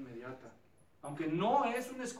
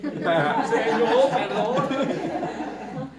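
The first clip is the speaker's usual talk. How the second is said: drawn-out, very loud, laughing perdóname; loud, hugely stretched mock wail through laughter; hammed-up wail; 3 semitones higher, 14 dB louder, much slower than usual. Exaggerated wailing plea for forgiveness.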